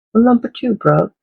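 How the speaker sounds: put-on playful British accent, like the boys at tea time; British accent done for fun, high on well; mock British accent. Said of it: "talk in an English accent or British accent".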